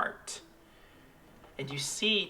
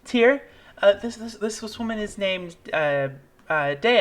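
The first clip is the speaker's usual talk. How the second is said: a hint of teary gnome woman; lightly voiced tearful woman; 7 semitones higher, 4 dB louder than usual. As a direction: gently voice a relieved mother.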